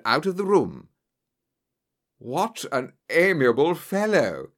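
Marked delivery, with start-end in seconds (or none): 2.2-4.5 mild amused remark, slow; a touch of laughter in the words; laughing. A narrator's reading. Holmes mocking the departed bully, mildly laughing. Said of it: "said Holmes, laughing".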